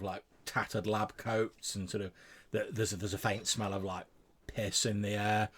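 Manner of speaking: ordinary delivery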